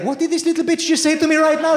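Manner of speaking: high-pitched mock outrage; hammed-up affronted disbelief, pitched right up; outraged grandmother. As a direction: act out an indignant, incredulous reaction. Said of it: "She's looking at her like".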